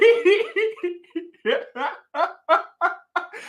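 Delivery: high-pitched helpless giggle. Real, not acted. Host cracking up at his own Wisconsin joke, high giggle. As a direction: burst into a high laugh.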